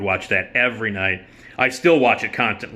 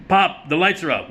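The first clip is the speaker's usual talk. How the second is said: Lamont calling out; 7 semitones higher than usual. Retold or imitated.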